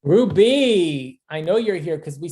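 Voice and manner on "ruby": loud, drawn-out name call. Real, not acted. Host calling a graduate she knows is here, calling out. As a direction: sing the name out, higher and louder, to summon someone.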